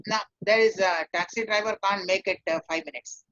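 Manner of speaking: ordinary talk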